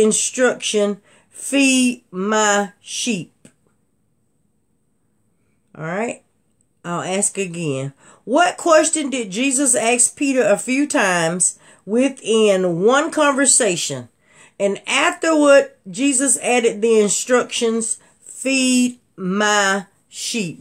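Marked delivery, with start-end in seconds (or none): 1.3-3.3 slow scripture quote. Retold or imitated. Quoting Jesus in Bible quiz. 18.2-20.6 slow scripture quote. Retold or imitated. Quoting Jesus in Bible quiz.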